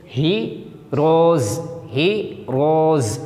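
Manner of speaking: हीरोज very slowly with a long z at the end; slow teacher demo of हीरोज; slow z sound. Shown as a demonstration. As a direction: stretch हीरोज out and buzz the z. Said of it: "तो ये जा की आवाज़ निकलेगा".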